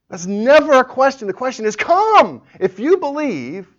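high, loud exhortation; forceful correction. Real, not acted. Preacher answering the doubter's question, forceful and loud. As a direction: cry out "Come" high above the rest.

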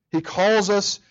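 ordinary delivery

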